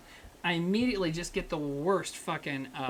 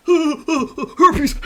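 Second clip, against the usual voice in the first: loud, high, guffawed taunt; mocking laughter; 7 semitones higher, 11 dB louder than usual. Streamer mimicking people who joke about canker sores, loud mocking laugh. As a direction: imitate someone cackling at their own lame joke.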